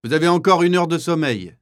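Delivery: high, loud, sustained call; shouted announcement. Acted. Kapo sending prisoners back to sleep, shouting. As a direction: shout it to the whole block. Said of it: "crie le capot".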